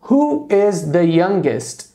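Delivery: slow, careful model question. Shown as a demonstration. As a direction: lay out each word for the class. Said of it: "por exemplo". (pergunta modelo bem devagar)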